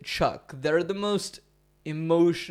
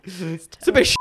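bad Irish accent. Shown as a demonstration. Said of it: "the closest I can get".

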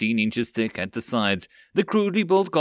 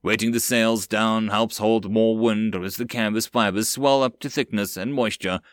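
usual speaking voice

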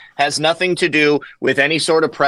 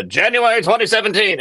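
put-on old newsreel announcer voice, higher; 1940s radio reporter; 7 semitones higher than usual. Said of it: "nineteen forties radio reporter effect".

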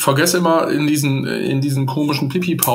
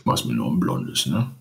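much lower, gruff bro voice; deep macho voice; 12 semitones lower, 6 dB quieter than usual. Retold or imitated. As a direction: play a macho bar guy. Imitating Camp David guy.

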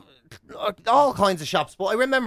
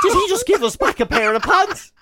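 imagined baffled clerk; 11 semitones higher than usual. Retold or imitated. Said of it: "she just must have thought".

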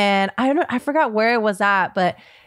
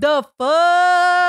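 shocked; 8 semitones higher, 4 dB louder than usual. Real, not acted. Shock seeing engagement ring.

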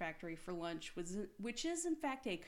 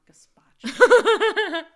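laughing loudly, slowly; 13 semitones higher, 26 dB louder, much slower than usual. Real, not acted. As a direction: laugh through the punchline. Joke laughed out.

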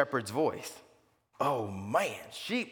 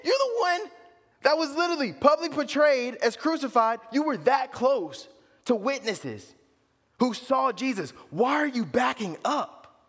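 high, loud exasperated plea; Paul pleading with the Galatians, high and loud; 13 semitones higher, 5 dB louder than usual. Retold or imitated. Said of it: "He's like".

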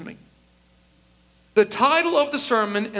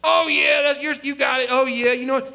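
mock yes-men; 4 semitones higher than usual. Pastor mimicking flatterers who cheered him on, in a higher, gushing voice. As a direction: gush like eager yes-men. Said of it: "I had a lot of yes people".

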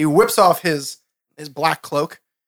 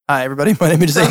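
ordinary talk, nothing marked

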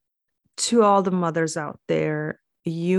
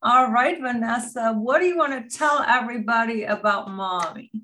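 high, sing-song mommy voice; bright and sweet, as if to a toddler; talking to a child; 5 semitones higher, faster than usual. Acted. Mom introduces her daughter to the podcast, high sing-song.

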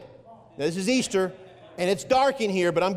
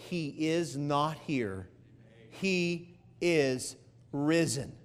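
slow and much softer, lower, almost reverent; hushed awe; 5 semitones lower, 7 dB quieter, much slower than usual. Acted. Preacher quoting the angel to the women, hushed and slow. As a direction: let the angel's news fall softly, slowly.

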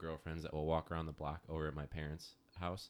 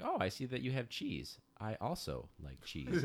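dog's voice; 6 semitones higher than usual. Acted. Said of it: "He'd be like".

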